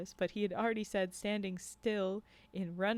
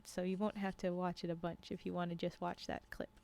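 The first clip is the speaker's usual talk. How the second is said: ordinary delivery, nothing marked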